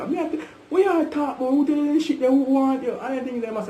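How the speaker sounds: "what you talk about" flung out high in disbelief; outraged disbelief. Real, not acted. Telling the friend about the lie, in high outraged disbelief. Outraged at the gossip being retold.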